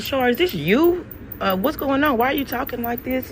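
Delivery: high, worried questions; freaked out. Retold or imitated. Asking her friend if it's really her, alarmed and high. I'm starting to freak the hell out.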